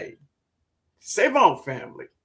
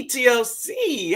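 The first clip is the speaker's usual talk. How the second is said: normal talking voice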